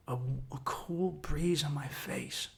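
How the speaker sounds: slow, soft whisper; slow whispered calm memory; whispered relief. Real, not acted. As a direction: breathe it out slowly.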